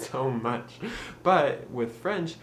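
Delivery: normal talking voice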